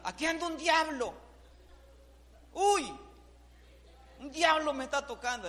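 high, loud mock scream of fright; retold frightened cry. Wife cries out in mock fright at her husband's touch. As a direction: shriek it like a startled wife. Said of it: "Me dice".

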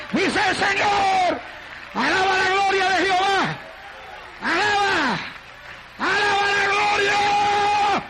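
prophetic proclamation rising to high shouts; full-force preaching. Real, not acted. Preacher declaring the Lord's word and calling for praise, full-force preaching. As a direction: shout the praise, stretching the final words.

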